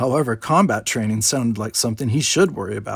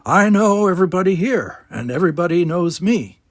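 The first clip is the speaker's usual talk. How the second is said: put-on grumpy old man; 7 semitones higher, 5 dB louder than usual. Gardener boasts.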